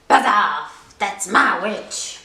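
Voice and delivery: low, loud monster growl; terrible beast voice. Acted. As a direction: warn the dragon off like a monster. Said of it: "in its terrible voice".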